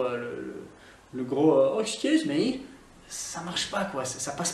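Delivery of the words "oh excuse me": high-pitched mock posh English accent; caricatured posh English accent, high. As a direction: send up a rich English snob, pitched well up. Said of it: "ça fait super posh, bolos, richos".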